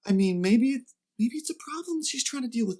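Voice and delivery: high voice, feigning innocence; mock innocent. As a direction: play it naive and puzzled. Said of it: "double over in the aisle laughing".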